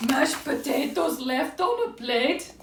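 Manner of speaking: high, slow weepy owl lament; hammed-up storybook sobbing; mock crying. A narrator's reading. Owl cries over sad things, high mock weeping. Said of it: "he cried".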